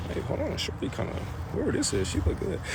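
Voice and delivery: hushed, breathy aside under the breath; quiet aside. Real, not acted. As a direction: lean in and whisper it half to yourself. Private aside about a woman.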